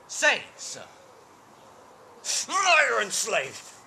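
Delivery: high, loud roar of accusation; furious outburst. Acted. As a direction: explode at the messenger in rage. King rounds on messenger.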